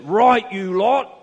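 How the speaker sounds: loud, high, slow barked order; British sergeant major. Retold or imitated. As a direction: bark it like a drill sergeant at the class. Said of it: "every teacher talks like a British sergeant major".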